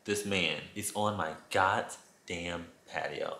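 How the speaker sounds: ordinary talk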